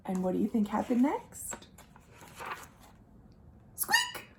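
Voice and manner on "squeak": tiny high squeak, softer; a very high, soft mouse squeak. Acted.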